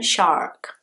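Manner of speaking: "shark" with an American R before the k; American accent. Shown as a demonstration. Teacher giving the American option, American accent on "shark". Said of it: "And if it's American".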